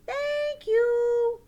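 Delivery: high, loud puppet thanks; high-pitched, stretched puppet voice; grateful puppet. Pretend.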